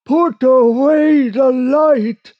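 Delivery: high, slow, loud distant call; long-drawn cry from across the water; unseen voice calling. Acted. Hidden boatman orders the sailors, high wailing call. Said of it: "as the voice bade".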